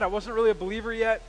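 ordinary delivery

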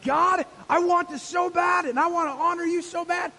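loud, high, impassioned shout; righteous anger. Acted. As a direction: cry out to God in holy fury. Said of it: "almost like righteous anger".

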